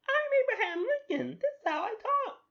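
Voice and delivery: very high, loud squeaky character voice; high-pitched annoying voice. Acted. Host claims to be Lincoln, absurdly high annoying voice. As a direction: squeal it at the top of your range. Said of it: "that high pitch annoying voice".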